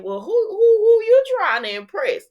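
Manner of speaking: high, loud mock-suspicious questioning; teasing challenge. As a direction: act out a sassy, soaring "who are you impressing". Acted teasing question in retold dialogue.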